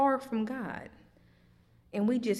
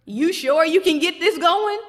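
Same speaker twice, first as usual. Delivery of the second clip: loud, higher mocking doubt; incredulous challenge; 8 semitones higher, 9 dB louder than usual. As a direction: throw the doubt back at her. Jesus mocking Martha's doubt.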